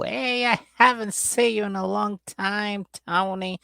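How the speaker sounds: high, drawn-out mock greeting; Debbie's gushing greeting. Debbie greeting Tony at their reunion, mock gushing. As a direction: greet them in a high, stretched-out put-on voice. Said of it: "they do the whole".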